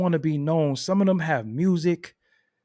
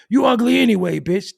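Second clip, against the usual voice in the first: loud, higher mock insult; rejected guy lashing out; 8 semitones higher, 9 dB louder than usual. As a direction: snap it out like a spurned dude. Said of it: "he be like".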